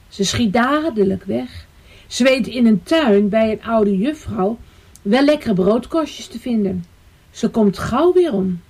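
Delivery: ordinary delivery, nothing marked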